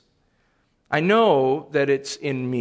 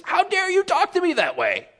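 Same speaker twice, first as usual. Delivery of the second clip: much higher pitched, indignant shriek; mock indignant outrage; 13 semitones higher than usual. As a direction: play the offended parent, pitched way up. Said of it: "My response is".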